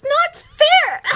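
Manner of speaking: slow high whine; whiny complaint. Acted. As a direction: whine it like a hurt kid. Child complaining.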